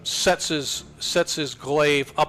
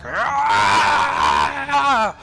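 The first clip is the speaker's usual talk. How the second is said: pained beast howl; 13 semitones higher, 7 dB louder than usual. Acted. Wolf man howls as moonbeam burns.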